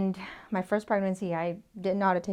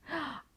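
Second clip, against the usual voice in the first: soft mock gasp; 5 semitones higher, 10 dB quieter than usual. Quoting mocking online commenters.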